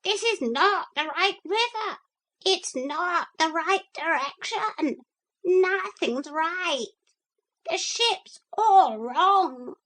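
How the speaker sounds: panicked parrot sounding the alarm. Acted. Parrot wakes Philip in alarm, high and frantic.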